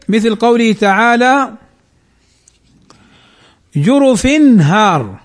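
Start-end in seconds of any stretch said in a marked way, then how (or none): 3.7-5.2 slow جرف هار, "jurufin haar", clear n before h; clear izhar. Shown as a demonstration. Teacher giving the haa example, slow clear n in جرف هار. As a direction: sound the tanween in جرف هار, then a long haar. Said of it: "مثال الهاء في التنوين".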